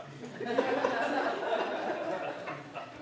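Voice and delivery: audible laugh at his own joke; breathy laugh after the punchline. Real, not acted.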